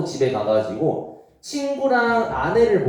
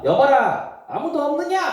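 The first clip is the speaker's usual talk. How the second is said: royal summons; 11 semitones higher than usual. Acted. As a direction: call for the servants like a king. King calls the servants. (높고 크게 신하를 부르는 왕)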